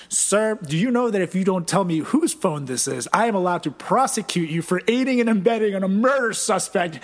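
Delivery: loud, hammed-up cop authority; threatening police officer. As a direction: lay down the law as a fake officer. Prank caller threatening as police.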